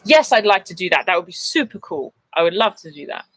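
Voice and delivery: eager and deliberately upbeat; enthusiastic. Shown as a demonstration. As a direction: redo it with keen, punchy energy. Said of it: "That didn't sound enthusiastic".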